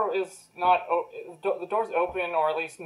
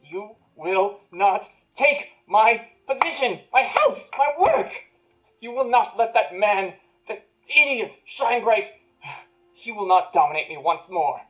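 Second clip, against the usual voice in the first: furious tirade; 2 semitones higher than usual. Acted. Wizard refusing to be dominated again, rising fury. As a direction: climb into rage word by word.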